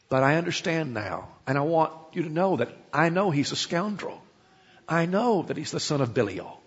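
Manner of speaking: calm him down gently; gentle plea. Retold or imitated. Abigail softly pleading with angry David to spare them.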